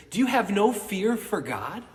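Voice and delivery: loud, high scolding cry; indignant rebuke. Acted. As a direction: rebuke the other man hard. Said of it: "rebukes him".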